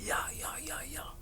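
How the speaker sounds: whispered, barely voiced prompting; hushed whisper. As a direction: whisper urgently to someone on a phone call. Retells police coaching him on phone.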